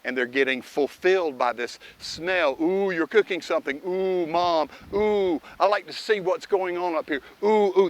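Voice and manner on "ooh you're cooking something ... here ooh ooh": high, eager puppy voice, sniffing around; excited dog voice. Retold or imitated. Trainer voicing the dog excited by cooking, high eager puppy voice. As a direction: voice the dog, excited by a kitchen smell. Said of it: "They're getting something out of it".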